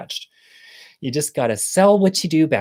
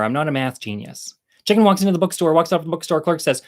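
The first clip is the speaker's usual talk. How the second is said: ordinary talk, nothing marked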